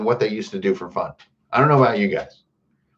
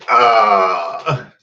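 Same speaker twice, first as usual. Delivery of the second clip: loud, drawn-out "ah" of pain; mock cry of pain; 5 dB louder than usual. Streamer playing stabbed victim, crying out.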